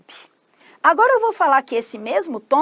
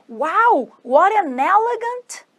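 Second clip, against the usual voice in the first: slow, higher-pitched exclamation of admiration; admiring wow; 7 semitones higher, 3 dB louder, much slower than usual. Acted. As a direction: gush over someone, drawing out the words.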